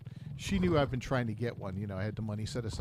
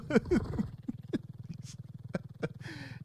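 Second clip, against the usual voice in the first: breathless silent laugh; helpless airy laughing; long wheezing laugh. Real, not acted. Laughing at own story.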